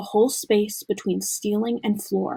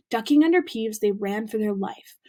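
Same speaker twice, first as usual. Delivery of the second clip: usual speaking voice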